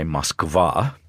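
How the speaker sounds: "Moskva" said slowly as mask-VAH; Russian pronunciation. Shown as a demonstration. Answering how Russians say it, slow Russian "Moskva". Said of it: "How do they say it in Moscow".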